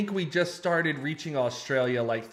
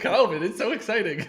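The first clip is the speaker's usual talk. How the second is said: sarcastic excitement; 6 semitones higher, 5 dB louder than usual. Acted. Sarcastic joke about covid.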